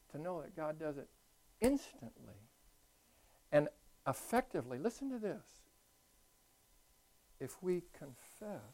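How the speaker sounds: ordinary talk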